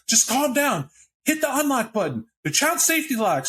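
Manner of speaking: high-pitched urgent cop telling someone to calm down; cop outside the car. Acted. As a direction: pitch way up as a rattled cop coaching someone through the locks.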